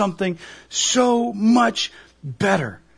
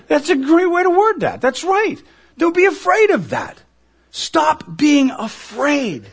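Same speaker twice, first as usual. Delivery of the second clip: 높고 크게, 외치듯; fervent preaching; 9 semitones higher, 5 dB louder than usual. Real, not acted. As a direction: lift it high and loud, fervent. Preacher rising to full force.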